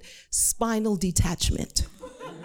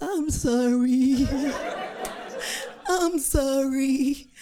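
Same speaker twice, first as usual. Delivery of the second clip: put-on high, drawn-out whimpering child; slow, whiny little-kid apology; caught five-year-old; 6 semitones higher, much slower than usual. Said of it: "I'm the five-year-old caught with the cookie".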